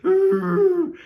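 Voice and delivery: high wailing mock sob; big exaggerated sobbing wail; retold sob. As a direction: wail it like a hammed-up crybaby.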